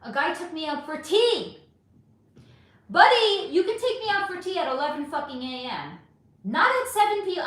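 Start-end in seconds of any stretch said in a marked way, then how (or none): 2.9-7.4 loud, high, exasperated scolding; a woman ranting at a bad date, loud and high; indignant outburst. Real, not acted. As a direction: tell off the tea-date guy, loud and high. Ranting about a bad tea date.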